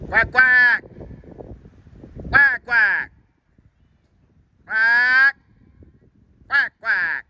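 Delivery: loud high mock quacking; duck quacks. Calling ducks on the pond.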